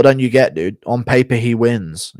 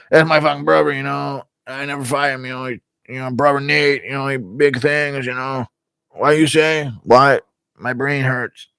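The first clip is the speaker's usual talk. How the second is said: halting, slightly raised muddled drone; present-day Nick Diaz; 4 semitones higher than usual. Acted. Nick Diaz nowadays on his brother, muddled halting drone. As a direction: lose the thread in a higher mumbling ramble. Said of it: "That's more Nick Diaz nowadays".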